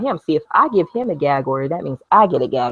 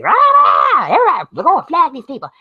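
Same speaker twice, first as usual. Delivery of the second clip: loud, high, roaring imitation; mock screaming rant; 12 semitones higher, 6 dB louder than usual. Vlogger imitating the man raging at his camera, mock screaming. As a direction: yell it hammed-up as a raving ranter. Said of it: "yelling and screaming".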